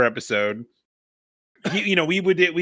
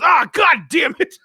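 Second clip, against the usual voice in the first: higher, louder "Ah, God damn it" in mock exasperation; mock frustrated shout; 12 semitones higher, 4 dB louder than usual. Friend quitting the accent with a mock frustrated shout. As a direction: blurt it out, fed up. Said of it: "he goes".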